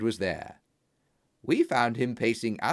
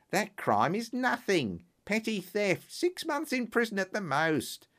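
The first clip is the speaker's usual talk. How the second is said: dismissive Lestrade; 6 semitones higher than usual. A narrator's reading. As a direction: brush the statues aside with scorn.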